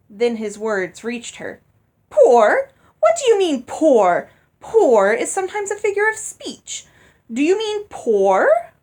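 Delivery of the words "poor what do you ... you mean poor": loud, high, shocked repeated "poor"; shocked disbelief. Acted. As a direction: shriek out the horror of the word. Stepmother learns husband is poor.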